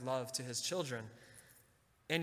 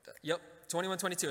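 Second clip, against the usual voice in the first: usual speaking voice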